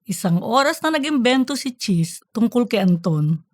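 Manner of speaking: ordinary delivery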